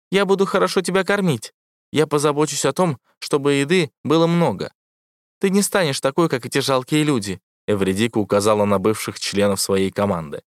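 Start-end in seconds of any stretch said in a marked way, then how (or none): 0.1-7.4 высокий ровный голос ИИ; говори как сладкий искусственный интеллект; голос ИИ Эвредики. A narrator's reading. (высокий голос ИИ)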